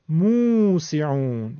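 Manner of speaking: موسعون very slow, with held first 'moo'; long vav madd. Shown as a demonstration. Teacher showing vav madd, drawn-out 'moo' in موسعون. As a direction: stretch the opening 'oo' of موسعون. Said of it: "اینجا واو مدیه".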